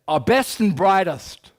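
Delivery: slow, lofty American officer voice; a proud brass hat boasting, slowly; American officialese. Retold or imitated. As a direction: puff it up like a Pentagon general. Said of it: "only Americans talk like that".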